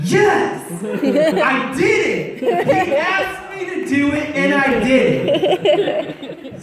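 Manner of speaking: high, loud cheer that breaks into laughter; the joy of finally doing what God asked, laughing; triumphant laughing cheer. Real, not acted. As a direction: celebrate like you just scored, laughing. Triumph after encouraging friend.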